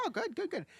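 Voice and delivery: put-on high, sing-song praise for a toddler; praising mom. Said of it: "I was like".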